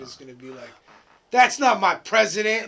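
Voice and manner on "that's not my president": loud protest-chant yell; mock outraged yell. Friend joking about changed voices, mock protest shout.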